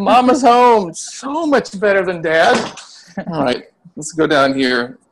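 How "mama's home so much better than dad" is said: high, loud mock welcome; sing-song cheer. Father voicing the kid greeting mom, teasing sing-song.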